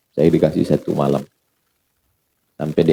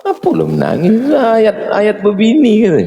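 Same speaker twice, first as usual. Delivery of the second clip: mocking congregant; 14 semitones higher than usual. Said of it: "kata makmum".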